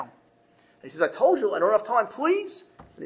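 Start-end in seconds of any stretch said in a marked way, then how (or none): 1.0-2.6 higher-pitched, mildly exasperated protest; exasperated refusal. Acted. Merchant refusing the wife's plea for lack of time, exasperated. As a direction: fend off someone who keeps begging.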